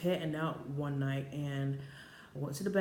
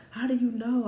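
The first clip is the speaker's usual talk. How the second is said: higher, louder imitated challenge; incredulous challenge; 8 semitones higher, 8 dB louder than usual. Boyfriend questioning the news by phone, incredulous. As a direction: demand it as a doubting boyfriend.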